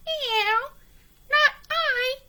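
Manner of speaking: a prissy cat saying no. A narrator's reading. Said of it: "said the cat".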